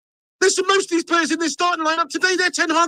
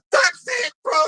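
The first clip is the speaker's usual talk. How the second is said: despairing shout; 8 semitones higher than usual. Real, not acted. Fan giving up on his team, despairing shout. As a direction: throw your hands up and yell it. Giving up after second goal.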